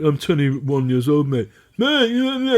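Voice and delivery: imitated posh student trying to sound streetwise; posh street persona. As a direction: mimic a posh youth putting on street talk. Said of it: "in a posh voice and".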